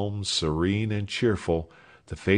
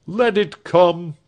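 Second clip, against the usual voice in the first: resolute; 8 semitones higher, 6 dB louder, slower than usual. Acted. Alfred answers the call.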